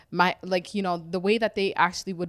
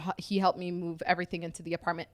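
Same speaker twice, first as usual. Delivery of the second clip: ordinary talk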